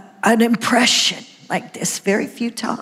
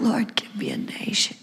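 softer whispered prayer; whispered plea; 2 semitones lower, 8 dB quieter than usual. Retold or imitated. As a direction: hush the prayer almost to nothing. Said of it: "I just cried out".

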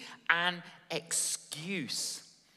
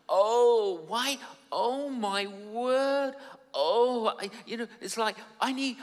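moaning sore man asking for a massage; groggy achy Lazarus; 3 semitones higher than usual. Retold or imitated. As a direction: ham up a stiff, sore man waking from death. Lazarus waking achy, comic.

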